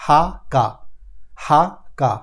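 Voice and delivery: হা and কা said as German H and K; teacher drilling হা, কা in a row; German letter sounds. Shown as a demonstration. German letters drilled aloud. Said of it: "এইচ এর উচ্চারণ হা".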